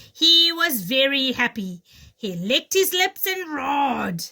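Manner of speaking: put-on lively read-aloud for kids; storybook reading. Teacher reading The Lion and the Clever Little Jackal to children, animated storytelling. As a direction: tell it like a bedtime tale.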